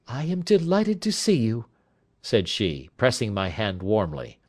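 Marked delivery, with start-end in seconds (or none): 0.1-1.7 high-pitched lady's greeting; gracious wife. Acted. Wife pressing the visitor's hand, high gracious voice. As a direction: greet a guest politely in a raised lady's voice.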